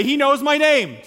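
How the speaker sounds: call-and-response. Shown as a demonstration. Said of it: "Turn to your neighbor and say".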